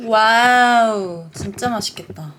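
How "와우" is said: long drawn-out wow. Real, not acted. First look at pizza.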